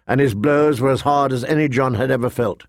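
ordinary delivery, nothing marked

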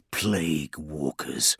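low, slow rasped whisper of disgust; hushed, contemptuous and drawn out; spat out. Acted. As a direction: spit it out quietly with loathing. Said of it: "he spat".

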